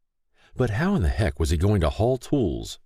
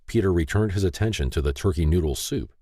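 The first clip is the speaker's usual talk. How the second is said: plain reading voice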